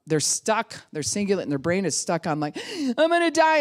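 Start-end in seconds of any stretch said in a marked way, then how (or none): 3.0-3.6 high loud panicked cry. Retold or imitated. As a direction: cry out in terror. Child's brain in panic.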